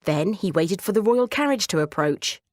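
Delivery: ordinary delivery, nothing marked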